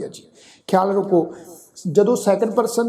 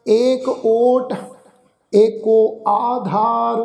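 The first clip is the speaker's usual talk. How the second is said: slow, drawn-out chanted reading of the verse; measured Gurbani path recitation; slow scripture recitation; 3 semitones higher, 4 dB louder, much slower than usual. Shown as a demonstration. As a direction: recite each line slowly and reverently. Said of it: "ਕਰੂੰ।".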